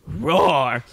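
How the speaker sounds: loud roar falling off; bear roar. Acted. Bear calling to his rival, loud falling roar. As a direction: give a firm cartoon roar.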